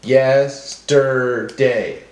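yes... ter... day, very slow; broken into syllables. Shown as a demonstration. Teacher helping class hear syllables, slowly one at a time. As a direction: sound it out beat by beat. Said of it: "break up our words into syllables".

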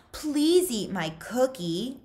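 mock pleading. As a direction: drag out the fake please.

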